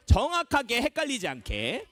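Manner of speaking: usual speaking voice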